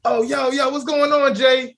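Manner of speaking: high-pitched, hyped greeting to a buddy; excited casual greeting. Acted. Coworker hyping up a greeting on seeing a friend, excited. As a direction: greet them loose and up high, stretched out. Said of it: "it's like when they see them, like".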